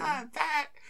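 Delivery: put-on very high sing-song girly voice; high playful girly voice; imitating the girl. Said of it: "She's like".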